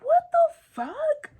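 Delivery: high, loud shocked "what the fuck"; shocked yell. Retold or imitated. To boyfriend caught at the park with a girl, a shocked high yell. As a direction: catch him red-handed, voice leaping up.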